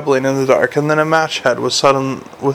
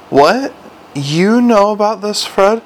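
raised, incredulous demand; 7 semitones higher than usual. A narrator's reading. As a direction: pitch up, demanding an answer from a subordinate. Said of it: "Vimes demanded".

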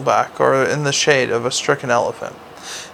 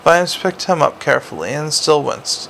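ordinary delivery, nothing marked

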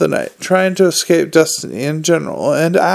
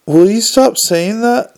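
higher, rattled plea; flustered protest; 6 semitones higher, 3 dB louder than usual. A narrator's reading. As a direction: protest in a raised, flustered pitch. Nobby panics at being pressed.

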